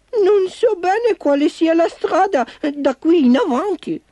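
very high little-car voice; uncertain little car. Acted. Baby unsure of the road, high worried voice. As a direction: pipe up with worry in a tiny voice.

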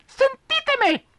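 very high tiny squeak; squeaky mouse. Acted. Mouse demands the animals listen, tiny squeak. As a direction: squeak for attention. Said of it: "squittì Topo".

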